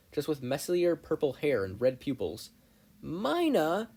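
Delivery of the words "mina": high, loud exasperated call; exasperated shout. Acted. Mei spotting her rival Mina, exasperated shout. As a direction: yell her name in disbelief. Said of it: "Mei shouted with exasperation".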